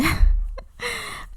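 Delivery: a sudden high giggle of excitement; high giddy laugh. Real, not acted.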